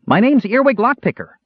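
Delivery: very high, eager character voice; thrilled little adventurer introducing himself; excited yell. A narrator's reading. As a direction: announce yourself in an excited high pipe. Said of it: "Earwig yelled in excitement".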